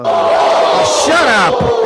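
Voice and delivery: loud, high shouted shut up; real shout. Real, not acted. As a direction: yell it out in frustration. Yelling at something off-stream.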